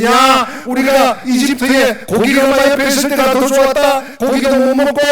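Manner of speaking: high, loud mock grumbling; resentful complaining. Israelites grumbling about meat in the wilderness, high resentful outburst. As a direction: complain loudly like a discontented crowd. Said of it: "원망이 터졌습니다".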